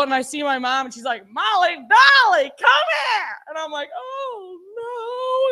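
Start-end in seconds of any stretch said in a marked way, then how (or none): 1.3-3.4 high, singing mother's holler, drawn out; mom calling out. Retold or imitated. 4.0-5.5 high, soft, drawn-out whimper; mock dread. Dread on seeing mother.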